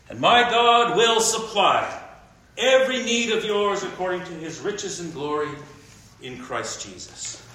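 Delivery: slow, emphatic Bible reading with a high peak on all things; preacher reading Romans aloud; scripture reading. Acted. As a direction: read the verse as a promise, lifting all things.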